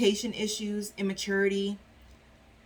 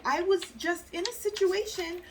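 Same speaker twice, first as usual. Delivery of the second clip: wary partner; 9 semitones higher than usual. Retold or imitated. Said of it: "they're like".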